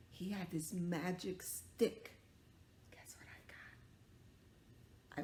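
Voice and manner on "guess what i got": slow secretive whisper. Real, not acted. Teacher whispering a surprise prop to kids.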